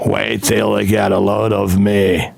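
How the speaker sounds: low, slow Joker line; Jack Nicholson Joker. Retold or imitated. Streamer's Joker impression praised by his friend, low and slow. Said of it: "you did a good Jack Nicholson thing".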